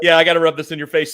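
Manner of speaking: fast, high, loud teasing; playful gloating. Real, not acted. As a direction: rub it in, quick and gleeful. Gloating aside while quoting a friend.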